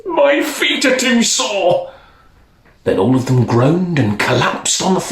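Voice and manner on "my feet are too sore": high, laughing cry of a tired king; hammed-up sore-footed king; old king begging to stop, high and laughing. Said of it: "when the king cried".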